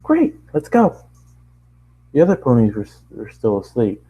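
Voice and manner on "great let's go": high, bright-pitched cheer; eager pony ready to go; happy. Acted. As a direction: squeal it out with delight. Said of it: "She happily jumped".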